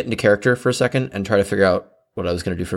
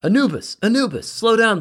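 high, raised call after a friend up the street; 12 semitones higher than usual. Acted. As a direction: call her back, high and urgent. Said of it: "Osiris Bloom called out to her".

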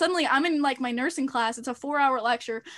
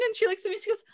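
ordinary delivery

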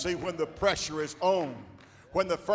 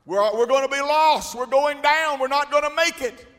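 mock frightened hollering, louder; panicked disciples; 7 semitones higher, 8 dB louder than usual. Disciples fearing the storm, mock panicked cry. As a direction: cry out like sinking men. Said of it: "men hollering out".

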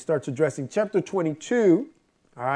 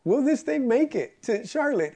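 higher-pitched worried retelling; mock-worried, pitched up; retold freaking out; 6 semitones higher than usual. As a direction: mimic your own fretting over a risky journey. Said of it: "on the way there freaking out".

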